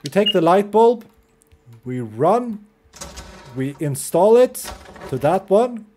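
ordinary delivery

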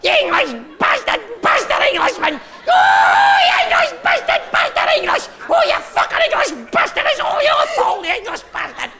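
very high, rapid-fire Scottish yapping; squeaky, hammed-up Scottish shouting; yappy terrier Scotsman. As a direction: yap it out like an angry little terrier. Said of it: "Type two's your little yappy terrier".

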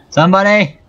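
calling for help. Real, not acted. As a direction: call out for help. Calling again louder.

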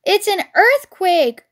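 high, loud, drawn-out alarm; crying out. A narrator's reading. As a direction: cry out a warning to the family. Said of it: "cried Missus Fox".

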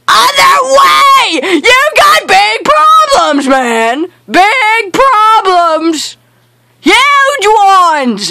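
high, drawn-out furious shout; mad yelling. Real, not acted. Gamer berates teammate for going wrong way, mad yelling. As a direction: shout it high, stretching the words slowly. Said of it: "I'm mad".

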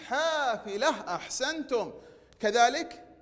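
ordinary talk, nothing marked

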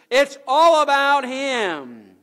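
very loud, high, long-drawn proclamation; climactic preaching shout. Real, not acted. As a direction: belt it out slowly to the whole congregation. Climax of list on church's focus.

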